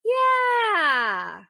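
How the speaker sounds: high, loud, stretched yeah; doubling down with a long high yeah; drawn-out yeah. Retold or imitated. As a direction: oversell the fake agreement. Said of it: "I was like".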